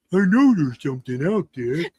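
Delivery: lightly put-on big beast voice; a hint of a lumbering forest creature; Bigfoot voice. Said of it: "That's my Bigfoot voice".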